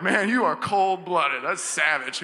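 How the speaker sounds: loud, drawn-out mock disbelief; mock shocked reaction. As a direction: tease as an appalled listener, loudly. Voices the audience's shocked reaction.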